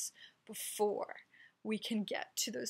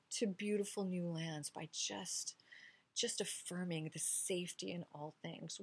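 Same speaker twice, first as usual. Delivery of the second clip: ordinary delivery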